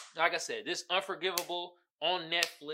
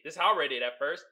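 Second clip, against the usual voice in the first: ordinary talk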